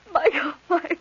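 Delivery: sobbing out his name; crying. Acted. Woman crying over her unconscious lover. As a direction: cry his name through tears.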